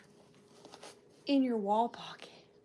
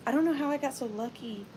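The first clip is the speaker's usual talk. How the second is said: ordinary talk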